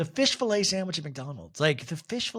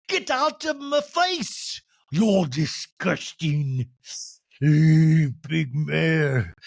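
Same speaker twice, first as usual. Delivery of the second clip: hammed-up fury; 5 dB louder, much slower than usual. Recapping the fight, she plays Meredith snarling at Whitney. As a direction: spit it at her like a cartoon villain.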